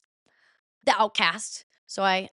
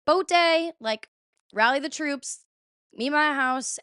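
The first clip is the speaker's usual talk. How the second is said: higher-pitched retold rallying call; excited summons; 6 semitones higher, slower than usual. Boyfriend's friend calls to gather everyone, excited. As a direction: lightly imitate a friend hyping the plan.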